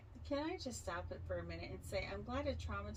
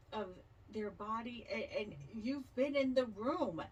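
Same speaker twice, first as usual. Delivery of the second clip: ordinary talk, nothing marked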